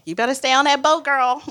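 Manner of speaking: high, quick motherly scolding; mom's warning. Retold or imitated. Mom warning her daughter about the boat, imitated scolding. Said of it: "I hear my mom saying".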